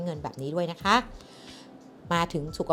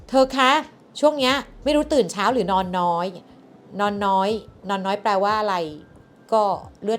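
loud calling out; 3 semitones higher, 8 dB louder than usual. Acted. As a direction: call out to grab a friend's attention. Calling out to listener.

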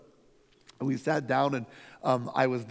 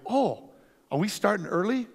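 lightly higher, innocent-sounding question; mock surprise; 6 semitones higher than usual. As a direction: play the clueless guest, a touch surprised. Naive guest question to pastor.

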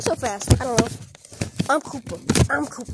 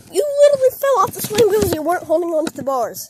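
fast, high, raised-voice rant; exasperated scolding; 5 semitones higher, 6 dB louder, faster than usual. Acted. Friend explains Koopa's fall to Koopa, exasperated rant. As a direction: rattle off the telling-off loudly.

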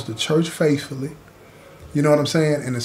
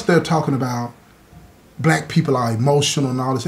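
ordinary talk, nothing marked

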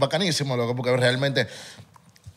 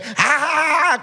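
"ja, ja, ja" bursting out much higher; real laugh; 10 semitones higher, slower than usual. Shown as a demonstration. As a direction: let a genuine laugh break loose. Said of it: "real cuando me estoy riendo es". (risa de verdad, aguda y suelta)

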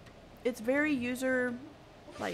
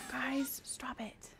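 whispered, much softer; hushed whisper; 8 dB quieter, much slower than usual. Real, not acted. Streamer whispering to chat to stop, hushed. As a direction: breathe it to chat like a secret.

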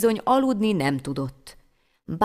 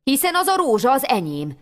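higher, louder, eager claim; excited; 6 semitones higher, 4 dB louder than usual. Acted. Boy recognises his own rose, excited. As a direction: blurt it out in delight.

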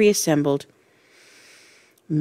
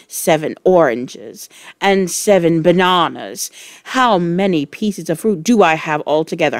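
bullying; 5 dB louder than usual. Acted. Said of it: "she said".

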